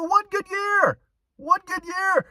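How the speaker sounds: high incredulous comedian riff; Jerry Seinfeld impression. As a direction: slip into a nasal New York sitcom comic voice. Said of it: "I almost went into my, uh, Seinfeld, uh, accent there".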